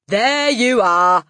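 loud, triumphant cry; Trunchbull. A narrator's reading. Headmistress catching out the teacher, loud triumph. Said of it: "cried the Trunchbull, triumphant".

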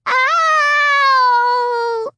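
put-on loud, high drawn-out child's cry of pain; a little girl's piercing wail; high heartbreaking wail. A narrator's reading. Little Alicia's heartbreaking wail of pain. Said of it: "uttered a high, heartbreaking wail".

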